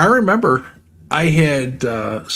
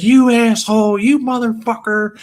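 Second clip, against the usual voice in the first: mock furious yell; 8 semitones higher than usual. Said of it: "She was going crazy".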